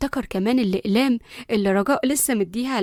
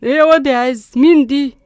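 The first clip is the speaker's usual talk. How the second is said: loud, stunned outburst; loud shocked yelling; 9 dB louder than usual. A narrator's reading. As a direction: cry out at the sight in shock. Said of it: "يوسف اتكلم بصدمة".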